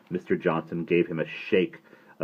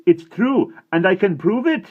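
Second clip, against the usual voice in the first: desperate insistence; 11 dB louder than usual. A narrator's reading. Boy insisting tearfully.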